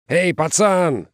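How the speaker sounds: high, punchy street call. A narrator's reading. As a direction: hail a kid from the crowd. Парень из толпы окликает героя.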